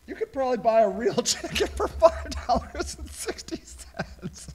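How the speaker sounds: high voice fading away into laughter; laughing disbelief. Real, not acted. Host incredulous at Farmville chicken prices, laughing. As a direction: protest it while starting to crack up.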